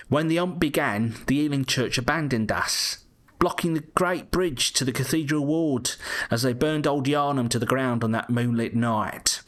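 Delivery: put-on Cockney storytelling, lifted pitch; Cockney Yarnum townsfolk accent. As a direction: recite it in a good old Cockney accent. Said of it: "the good old Yarnum accent".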